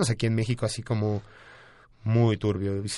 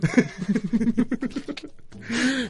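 high-pitched burst of laughter; 12 semitones higher than usual. Real, not acted. Laughing at his own joke.

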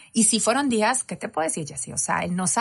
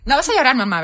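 loud, raised mock warning; teasing sons; 4 semitones higher, 6 dB louder than usual. Sons warning their mother before a film, loud mock scolding. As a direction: playfully scold like a child policing mom. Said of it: "con los hombres me da risa".

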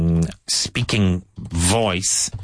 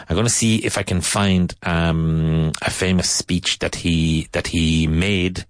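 ordinary delivery

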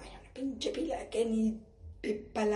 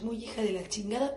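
ordinary talk

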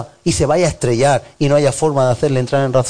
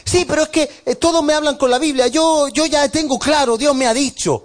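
higher, insistent protest; mock stubborn believer; 11 semitones higher than usual. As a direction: play the member insisting God spoke, higher. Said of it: "member quoted again".

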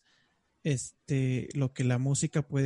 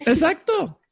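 excited squeal; 7 dB louder than usual. Real, not acted. Laughing, excited agreement.

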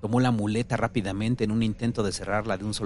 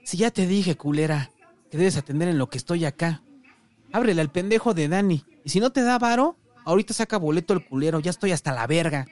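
high-pitched rant, building in volume; angry shouting; 6 semitones higher, 4 dB louder than usual. A narrator's reading. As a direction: yell at your wife, utterly fed up. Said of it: "Volvió a resoplar y gritó".

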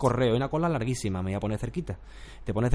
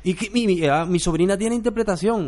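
mock pleading acquaintance; 7 semitones higher, 5 dB louder than usual. Voicing people asking favours.

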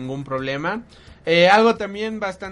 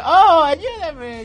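high pleading cry; 10 semitones higher, 9 dB louder, much slower than usual. Retold or imitated. Retold plea from the scene.

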